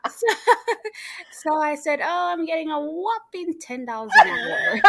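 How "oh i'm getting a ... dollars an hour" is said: high, laughing delivery; laughing through it. Real, not acted. Telling her friend the hourly pay, laughing.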